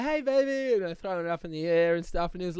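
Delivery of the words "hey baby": imitating a dad cooing high to his baby; a playful high coo to the baby; high baby talk. Dad tossing his baby, a high playful coo.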